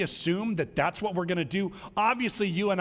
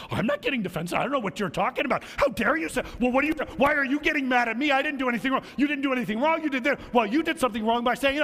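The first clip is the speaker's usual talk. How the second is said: higher, louder, fast indignant protest; heated defensive outburst; 8 semitones higher, 3 dB louder, faster than usual. Acted. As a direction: get worked up and defend yourself. Spouse escalates quarrel.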